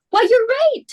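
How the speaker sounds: high, loud dismayed exclamation; the penny drops with a jolt; alarmed realisation. Acted. Hostess realising they'll be thirteen at table, high alarmed cry.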